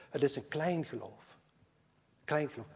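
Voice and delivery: ordinary talk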